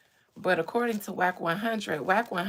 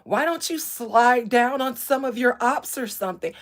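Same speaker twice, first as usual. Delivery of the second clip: loud, higher mock taunt; imitated young streamer; 5 semitones higher, 6 dB louder than usual. Streamer quoting a young man goading his rival, mock snippy. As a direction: mimic a privileged kid's snippy challenge. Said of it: "he went on to say".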